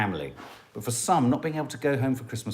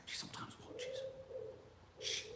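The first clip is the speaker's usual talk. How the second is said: whispering; 20 dB quieter than usual. Real, not acted. Hiding from daughter who may watch.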